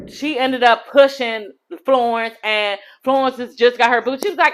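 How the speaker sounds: ordinary delivery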